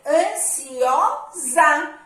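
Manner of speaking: "Ansiosa" very slow, final "sa" sounded as "za"; drawn-out za sound. Shown as a demonstration. Teacher showing pupils the z sound in "Ansiosa", drawn out. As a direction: stretch "Ansiosa" to show the buzzing "za" at its end. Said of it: "e o som de za".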